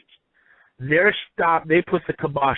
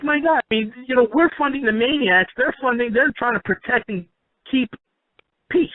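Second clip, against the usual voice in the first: much higher, retold exasperation, half in disbelief; exasperated outburst; 8 semitones higher than usual. Speaker recalling his reaction to US policy, higher exasperated outburst. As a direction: throw up your hands at funding the maniacs. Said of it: "And I'm like".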